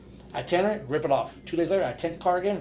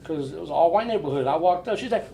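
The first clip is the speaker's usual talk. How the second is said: ordinary delivery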